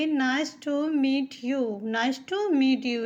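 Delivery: "nice to meet you" spoken very slowly; slow model phrase for beginners; slow teaching read. Shown as a demonstration. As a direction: dictate it word by word. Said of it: "हम English में कहेंगे".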